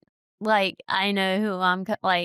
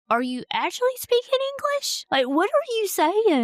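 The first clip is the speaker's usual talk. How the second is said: mock bewilderment; 12 semitones higher than usual. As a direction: act totally baffled, pitch shooting way up. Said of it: "I can't understand the slang British people".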